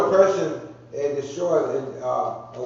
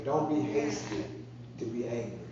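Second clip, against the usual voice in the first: soft repeat; 5 semitones lower, 5 dB quieter than usual. Real, not acted. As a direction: let the warning sink in quietly. Preacher repeating scripture line softly.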